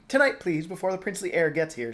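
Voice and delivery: begging politely but firmly; Sophie, pleading. Acted. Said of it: "Oh, sorry".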